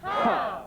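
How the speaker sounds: short high grunt. Shown as a demonstration. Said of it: "Let's try one more time".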